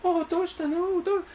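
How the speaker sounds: high-pitched Japanese schoolgirl concern; concerned girl imitation. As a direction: coo it high as a concerned classmate. Voices a girl consoling the crier.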